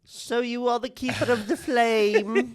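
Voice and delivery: high, slow, grand royal impression; mock king voice. Friend imitating the king greeting Hank, high and slow. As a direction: drawl it out like a pompous king. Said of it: "that's how he talks to you".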